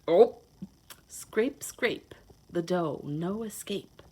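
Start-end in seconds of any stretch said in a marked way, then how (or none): none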